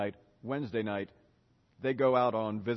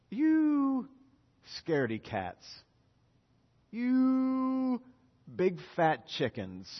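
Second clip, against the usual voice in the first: a hint of playful rebuke, first word lifted high; gentle teasing; 8 semitones higher, slower than usual. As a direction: tease them like a fond friend. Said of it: "He says".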